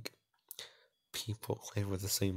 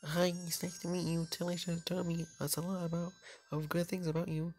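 put-on higher, friendly girl voice; a higher put-on girl voice for the new friend; Frankie's voice; 7 semitones higher than usual. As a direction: greet a stranger in a bright new character voice. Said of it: "I don't know what voice I can give you".

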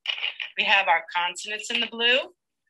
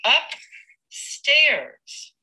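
slow blended word; 6 semitones higher, 3 dB louder than usual. Shown as a demonstration. Teacher blends the word for the class, slowly. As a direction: say upstairs slowly, joined up. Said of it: "what we would do is".

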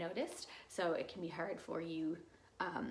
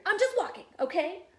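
loud, high, irritated protest; shouting; 11 semitones higher, 11 dB louder than usual. Acted. Bird snapping back at Fox's question, exasperated shout. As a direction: protest at the top of your voice. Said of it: "shouted Bird".

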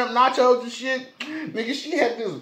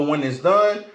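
food held against lips; words muffled by bite; talking while biting food; 8 semitones lower than usual. Real, not acted. Seen 1: Lobster meat pressed to lips, biting throughout the labelled words.

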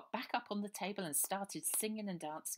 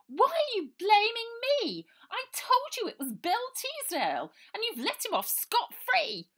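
put-on much higher, loud shouting in a posh English accent; furious outburst; 16 dB louder than usual. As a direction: yell at the closed door, outraged. Girl hammering on the door.

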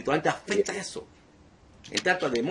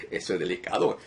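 ordinary talk, nothing marked

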